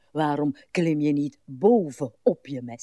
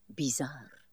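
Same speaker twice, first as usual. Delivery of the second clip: bizar whispered on its own; a secretive whisper; whispered word; 9 dB quieter than usual. Acted. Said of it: "Vind je mijn manier van spreken".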